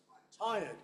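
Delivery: weak "I'm", "tired" stressed and falling. Shown as a demonstration. Teacher repeating the unstressed pronoun example, stress on tired. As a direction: barely say "I'm", land on "tired". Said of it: "Neither of those are usually stressed".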